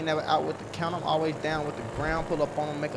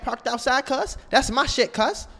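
high, louder hammed-up rant; mock angry shout; 8 semitones higher, 6 dB louder than usual. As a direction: yell like a hothead bragging. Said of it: "calm down".

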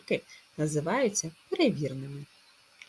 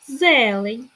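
"Зелень" high and loud on зе; "Зелень", stretching the first е; stress on first е; 5 semitones higher, 10 dB louder than usual. Shown as a demonstration. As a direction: punch the first syllable of "Зелень". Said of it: "Наголос на першу е".

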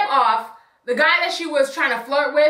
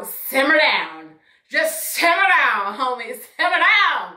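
slow simmer down; slower than usual. Retold or imitated. Calming the rude girl.

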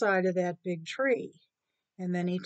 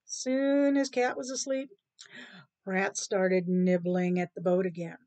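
ordinary delivery, nothing marked

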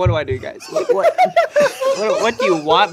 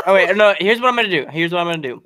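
ordinary talk

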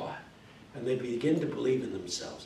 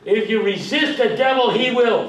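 loud, high revival preaching; Pentecostal preaching; 9 semitones higher, 13 dB louder than usual. Shown as a demonstration. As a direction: preach it hard, pulling answers from the crowd. Repeated call and response.

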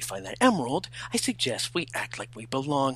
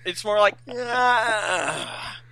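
yawn demo; 9 semitones higher, much slower than usual. Shown as a demonstration. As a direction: show them how a yawn sounds. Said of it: "Do, uh, come on".

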